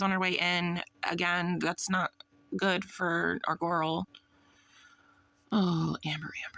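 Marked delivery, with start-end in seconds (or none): 5.5-6.6 soft pitying whisper. Real, not acted. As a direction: breathe it out in disappointment. Pitying sigh at Amber.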